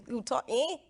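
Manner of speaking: a light Jamaican lilt on the tag; Jamaican imitation. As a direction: lightly mimic her Jamaican fiance's speech. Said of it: "That's how he talks".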